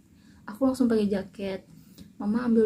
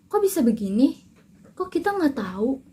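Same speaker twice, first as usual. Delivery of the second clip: higher and louder, flustered questions; agitated neighbours; 4 semitones higher, 6 dB louder than usual. Retold or imitated. Neighbours crowding in, asking in loud alarm. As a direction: rattle them off like alarmed neighbours.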